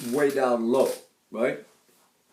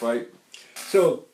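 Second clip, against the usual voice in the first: ordinary delivery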